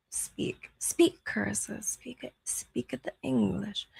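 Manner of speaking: unvoiced hushed whisper. Real, not acted. Nudging Carissa off-mic, in a whisper. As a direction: whisper it so the mic barely hears.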